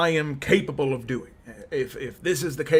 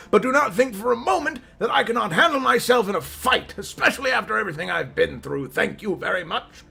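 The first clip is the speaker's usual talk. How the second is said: raised voice, higher and louder, scolding; indignant yelling; 5 semitones higher, 3 dB louder than usual. Acted. Old adventurer answers warning that it's dangerous, indignant yelling. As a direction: yell it like an affronted veteran adventurer. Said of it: "I didn't mean to yell".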